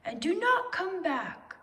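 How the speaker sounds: high, loud parting call. Acted. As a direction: call it after him sharply. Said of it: "called Owl".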